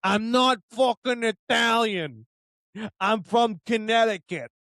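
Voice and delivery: imitated East Coast Italian-sounding accent, indignant; put-on Connecticut-by-way-of-Italian accent; mock Connecticut accent. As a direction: mimic an East Coast guy's accent, protesting. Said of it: "he has that stereotypical Connecticut accent".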